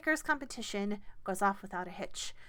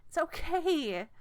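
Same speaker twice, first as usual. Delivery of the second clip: lifted, louder okay; a little higher, louder it's okay; higher reassurance; 9 semitones higher, 6 dB louder, slower than usual. Acted. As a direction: soothe with a brighter lift.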